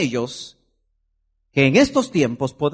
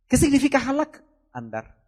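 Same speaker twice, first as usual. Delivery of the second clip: ordinary delivery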